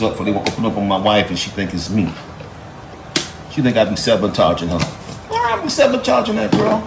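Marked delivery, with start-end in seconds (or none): 5.3-6.8 high-pitched mock indignation; mock-innocent protest. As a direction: plead innocence in a much higher voice. Mock protest at wife's suspicion.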